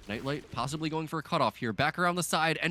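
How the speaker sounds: quick play-by-play rising in pitch and volume; building excitement. Real, not acted. Caster following a chase as it tightens, excitement building. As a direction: build urgency toward the big move.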